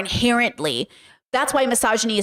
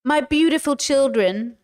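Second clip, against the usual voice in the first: slow, put-on mimicry of a doting father; mock-sincere parroting; mocking imitation; 3 semitones higher than usual. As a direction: draw it out as a mocking echo. Mimics the clip just played.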